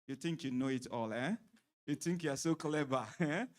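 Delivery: mocking sneer with a laugh; passive aggressive. Shown as a demonstration. Husband demonstrating a passive aggressive jab at his wife, mocking. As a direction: needle her with a sarcastic jab. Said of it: "that's passive aggressive".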